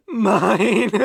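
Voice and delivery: loud, high burst; manic possessiveness. Acted. Captor exults over his captive, manic possessiveness. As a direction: burst out, tipping into laughter.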